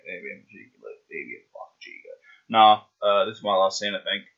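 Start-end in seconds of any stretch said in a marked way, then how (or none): 0.1-1.0 low muttering to self. Real, not acted. 1.1-2.2 lower, fast mutter to self. Real, not acted.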